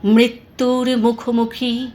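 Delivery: usual speaking voice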